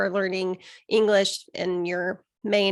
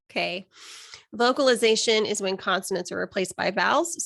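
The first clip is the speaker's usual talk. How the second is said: ordinary delivery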